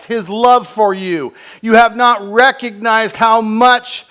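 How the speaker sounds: loud, high impassioned preaching; fervent pulpit cry; full-force preaching. Acted. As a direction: preach it out with rising fire.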